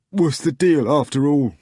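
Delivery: high, loud bitter protest; bitter demand. Acted. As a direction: demand it with resentment. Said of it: "demanded bitterly".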